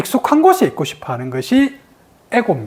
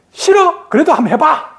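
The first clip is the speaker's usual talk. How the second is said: high-pitched acted-out no; instinctive refusal; 7 semitones higher than usual. Person not yet ready, instinctive refusal. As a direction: voice a reflex refusal. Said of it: "수준이 안 되는 사람한테".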